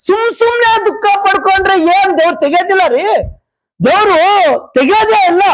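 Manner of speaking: high-pitched sermon challenge; rebuking preaching. Real, not acted. Preacher rebukes needless grieving.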